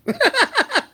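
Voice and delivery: loud squealing laugh; high laugh. Real, not acted. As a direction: burst out laughing. Laughing at own impression.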